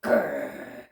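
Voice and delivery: low growl like a pirate; low pirate-style growl. Acted. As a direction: growl it out like an old sea dog. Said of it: "I sounded like a pirate then".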